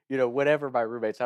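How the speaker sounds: ordinary talk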